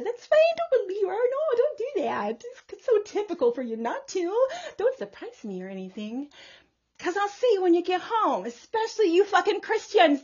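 high mocking voice of God; voicing God. Prophetess voices God dismissing Christians, high and mocking. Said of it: "said God".

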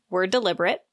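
ordinary talk, nothing marked

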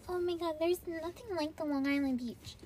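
gushing beachgoer, mocked; mock gushing local. Mocking locals who rave about their beach. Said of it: "People are like".

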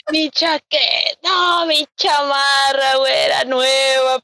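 drawn-out, high lament; hammed-up anguish, stretching every word; mock wail. Student mourning the new jacket left upstairs, mock wail.

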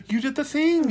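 high, loud, gasping delight; delighted surprise. Real, not acted. Host reacting to her cohost's big news, surprised and delighted. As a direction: jump in pitch, thrilled for her.

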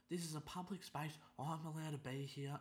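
high, huffy mock old-lady voice. Old woman insists.

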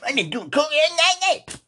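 high, loud mocking imitation; mock quote. Mocking his friend's late-night boast, high and loud. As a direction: squeal it out like a taunt. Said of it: "You were like".

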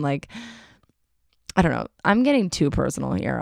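normal talking voice

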